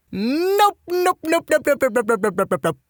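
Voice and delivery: high-pitched rapid-fire cartoon voice; comic panicked refusal. Narrator recoiling from the creepy question, high comic panic. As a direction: start high and rattle it off, tumbling downward.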